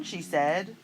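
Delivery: usual speaking voice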